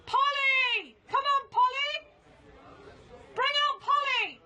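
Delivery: high, loud drawn-out calling; shouted call. Real, not acted. Protester calling Polly out of the venue, shouting. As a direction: holler for someone to come out.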